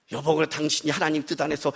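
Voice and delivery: low, soft soothing voice; calming wife. Retold or imitated. Wife reassuring her venting pastor husband, calming. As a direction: soothe him gently, pitched low.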